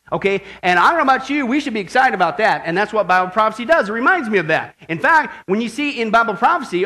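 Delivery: energetic, emphatic scripture preaching; fervent preaching. Real, not acted. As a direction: proclaim the text to the whole church.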